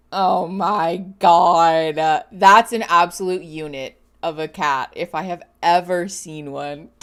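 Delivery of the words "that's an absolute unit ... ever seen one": ordinary delivery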